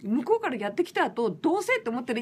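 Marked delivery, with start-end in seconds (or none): none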